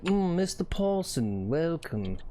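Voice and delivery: slow, fancy greeting from a snooty host; imitating a snooty club host; posh host voice. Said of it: "Be like".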